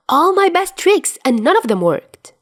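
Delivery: high, loud mock-wounded cry; exclaiming. A narrator's reading. Dazai to Chuya over his tricks failing, high loud playful exclaim. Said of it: "Dazai exclaims".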